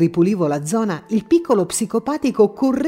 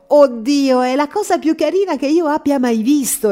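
higher, gushing burst over a cute puppy; doctor gushing over the puppy; delighted exclamation; 6 semitones higher, 3 dB louder than usual. A narrator's reading. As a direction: exclaim with delight at first sight. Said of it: "esclamò".

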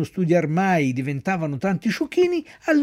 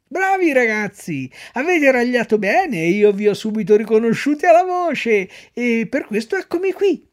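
little man's sly voice; 7 semitones higher, 4 dB louder than usual. A narrator's reading. The little man mocking the two donkeys, sly and high. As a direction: wheedle them sweetly like a sly trickster. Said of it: "col suo solito risolino".

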